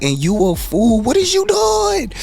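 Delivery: high, loud, drawn-out scolding; mock outrage. Teller scolding his serenading friend, mock outrage. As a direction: cry out at his foolishness, pitch soaring.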